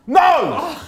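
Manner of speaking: high, loud yell of dismay; real shout. Real, not acted. Player reacting to the game, loud shout. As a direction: shout it out at the screen.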